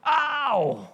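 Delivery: high, crying howl of pain; giant's pain roar. Acted. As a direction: howl as a stone hits your head. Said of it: "he roared in pain".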